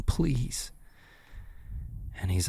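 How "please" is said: small, hushed, higher plea; retold begging. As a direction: softly imitate someone begging. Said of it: "and is begging".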